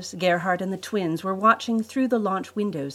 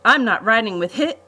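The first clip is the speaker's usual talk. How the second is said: loud, raised-pitch protest, cut off mid-word; 5 semitones higher, 7 dB louder than usual. A narrator's reading. Brother is grabbed by the twins mid-objection, protesting. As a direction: object hotly until interrupted. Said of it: "Gerhardt protested".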